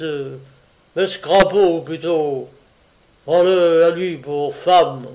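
loud mocking bellow; yelling. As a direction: shout it like a brutal boss. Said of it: "hurla le chef".

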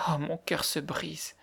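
slow, hushed heartbreak; anguished murmur. A narrator's reading. Girl fearing Jem will hang, anguished murmur. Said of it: "murmura-t-elle avec angoisse".

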